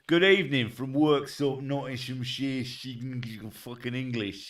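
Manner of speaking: thick put-on Nottinghamshire greeting, dropping away; mock Nottinghamshire accent. Streamer showing how the Worksop greeting goes, mock Nottinghamshire accent. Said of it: "that's a Nottinghamshire accent".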